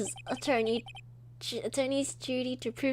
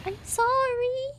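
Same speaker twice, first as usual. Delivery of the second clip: much higher and slow, drawn-out sorry; 4 dB louder, slower than usual. Acted. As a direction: stretch each sorry out, high and slow. Character apologises again.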